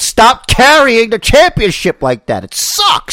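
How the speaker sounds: worked-up complaint; exasperated rant. Real, not acted. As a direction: pitch climbing to a high yell. Ranting about belt carrying.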